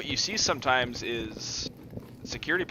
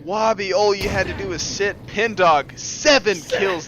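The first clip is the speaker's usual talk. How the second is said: high, loud hype call; excited caster call; 7 semitones higher, 7 dB louder, slower than usual. Real, not acted. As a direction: shout it over the clutch. Caster reacts to clutch round.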